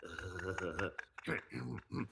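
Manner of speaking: soft dopey cartoon chuckle; slow-witted stuttering "huh-huh-huh"; Beavis and Butthead laugh. Cartoon duo laughing at the host, dopey chuckle. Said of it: "when I do Beavis and Butthead".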